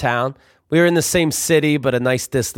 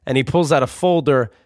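ordinary talk, nothing marked

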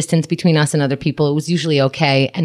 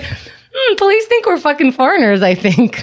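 high, wry pondering aside; high wry mock musing; 11 semitones higher than usual. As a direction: puzzle it over with dry irony. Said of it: "And I'm like".

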